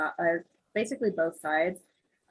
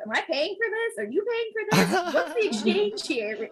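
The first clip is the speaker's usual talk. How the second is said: high, mock-outraged questions; sarcastic disbelief; 10 semitones higher than usual. Patient to doctor, high sarcastic outrage. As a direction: play the indignant customer.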